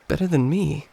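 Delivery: usual speaking voice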